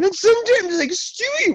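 squeaky voice. Pretend. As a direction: jump way up into a squeal. Sudden squeaky voice.

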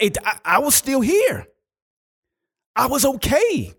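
very high, exclaimed in disbelief; amazed realization. Retold or imitated. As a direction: cry it out like a joyful revelation. Acting out amazed realization of survival.

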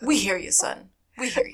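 patronizing. Retold or imitated. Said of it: "very patronizing".

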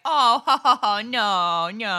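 chuckling no no, to soothe; laughing reassurance. Retold or imitated. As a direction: laugh it off loudly, slowly. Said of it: "everybody was like".

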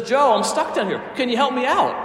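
acted appeal from a trapped man; calling for help. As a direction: call up eagerly to your friend. Trapped man asks friend for help.

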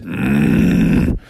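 very low sleepy "mm"; sleepy bear grunt. Acted. Hibernating bear being shaken, low sleepy grunt. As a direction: rumble low, half asleep. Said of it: "And he said".

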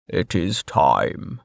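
low and very slow; solemn Westbridge. A narrator's reading. Westbridge announcing the moment, low and slow. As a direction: weigh every word.

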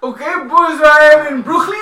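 high, loud hammed-up shout as Harden; mock James Harden. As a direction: announce it to the boys like a proud Harden. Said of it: "You know what he's gonna say now?".

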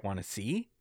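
low, quiet, sinister voice; creepy soft offer. Retold or imitated. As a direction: offer it softly and ominously.

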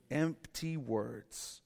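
"Empty" stressed and drawn out very slowly. Acted. Pastor repeating the verse's key words, very slow. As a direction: lean hard on "Empty", slowly. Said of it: "Let no one deceive you with empty words".

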